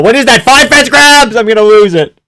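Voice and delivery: loud, high exasperation; outraged shout. Real, not acted. Fan counting fence grabs, outraged yelling. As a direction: shout it like a fan losing patience.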